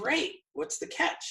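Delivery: mock suspicious. Retelling his reply to his friend, mock suspicious and high. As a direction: play it up as a wary skeptic. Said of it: "And I go".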